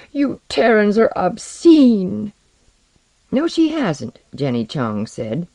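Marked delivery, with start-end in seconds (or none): 0.1-2.3 high, loud, slow tearful cry; tearful outburst. A narrator's reading. Alaroo bursts into tears at the crew, tearful outburst. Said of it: "burst into tears and cried". 3.3-4.2 high-pitched, quick-to-defend retort; prompt defense. A narrator's reading. Jenny Chong defends Alaroo, prompt defensive retort. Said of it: "prompt to defend".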